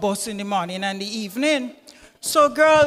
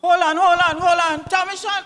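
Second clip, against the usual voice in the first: calling for order; 5 semitones higher than usual. Acted. Mother breaks up the argument.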